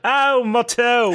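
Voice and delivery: pained yelp, high and slow; stubbed toe. Pretend. Javelin lands on his toe.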